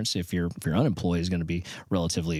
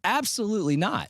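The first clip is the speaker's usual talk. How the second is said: higher-pitched mock dad flatly refusing; imitating a stern father saying no, pitched up; strict dad refusing; 11 semitones higher than usual. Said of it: "My dad would be like".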